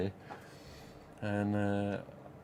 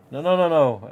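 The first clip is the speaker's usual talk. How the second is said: insistent refusal; 5 semitones higher, 7 dB louder than usual. Retold or imitated. RJ insisting on paying.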